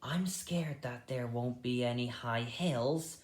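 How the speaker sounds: frightened whisper, no voice; scared whisper. Acted. Fiver shivering in the puddle, whispering his fear. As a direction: breathe it out in fear. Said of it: "said Fiver".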